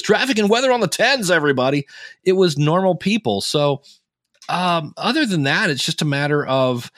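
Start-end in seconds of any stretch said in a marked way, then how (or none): 0.0-1.9 hammed-up radio announcer, much higher and brighter; mock traffic-report announcer. Shown as a demonstration. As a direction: sell it like a morning-drive DJ. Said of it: "'cause they didn't talk like this".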